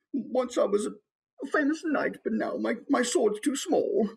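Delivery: high, woeful knight voice; sad knight. Acted. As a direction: lament faded glory, high-pitched. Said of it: "he's a little bit sad".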